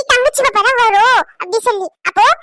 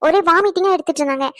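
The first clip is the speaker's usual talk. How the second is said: usual speaking voice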